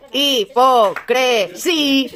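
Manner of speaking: Hipocresía very slowly, each syllable separated. Shown as a demonstration. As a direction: dictate Hipocresía in drawn-out, isolated syllables. Breaks the word up for spellers.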